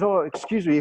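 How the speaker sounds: put-on light, polite British accent. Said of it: "It was so British".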